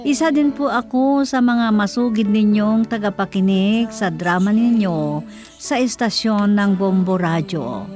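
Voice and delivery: ordinary talk, nothing marked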